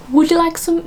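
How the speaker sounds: high-pitched attempt at posh royal English; the Queen's accent. Shown as a demonstration. Trying out the Queen's sentence about tea, posh RP accent. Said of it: "it's the Queen".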